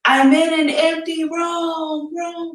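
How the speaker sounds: echoing in an empty room; echoing stairwell. Real, not acted. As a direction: call out into an echoing space. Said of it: "Can you all hear the echo in here".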